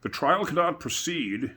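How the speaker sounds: slow, high, grave pronouncement; a king's solemn decree, slowly. A narrator's reading. The king addressing the court, lightly voiced and grave. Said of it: "said the king in a very grave voice".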